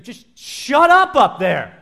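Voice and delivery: loud, high, slow yell; scream. Retold or imitated. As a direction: yell up at the roof, fed up. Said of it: "just scream".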